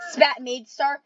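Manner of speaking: ordinary delivery, nothing marked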